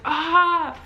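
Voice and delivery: short high mock yell; mock scream. Woman squeezing the doorway through a contraction, mock scream. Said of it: "But I wasn't really screaming".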